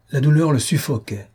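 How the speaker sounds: ordinary delivery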